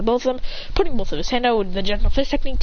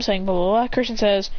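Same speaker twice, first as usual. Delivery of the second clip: plain reading voice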